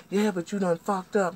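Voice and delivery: high, loud accusation; high-pitched insistent blame; angry retort. Retold or imitated. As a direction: cut in with an acted scolding. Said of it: "she going off on me".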